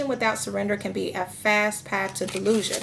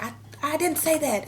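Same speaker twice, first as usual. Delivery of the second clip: sharp, higher denial, retold; frustrated denial; 6 semitones higher than usual. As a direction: snap back in mild mock frustration. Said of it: "I get frustrated".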